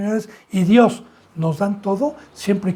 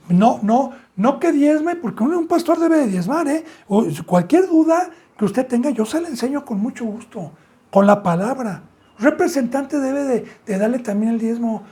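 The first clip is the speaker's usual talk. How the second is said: heated preaching; 6 semitones higher than usual. Real, not acted. Pastor presses the congregation about tithing, heated and high. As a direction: pitch up high, pressing each point.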